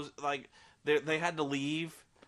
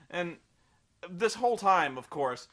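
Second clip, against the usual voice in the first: ordinary delivery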